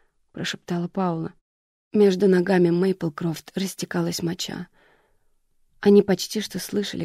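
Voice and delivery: ordinary delivery, nothing marked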